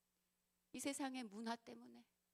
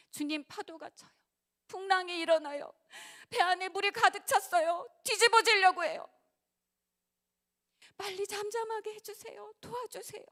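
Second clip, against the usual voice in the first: much higher and louder frightened plea; panicked cry for help; 10 semitones higher, 14 dB louder than usual. Retold or imitated. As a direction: beg for rescue as the boat sinks. Disciples crying out in storm.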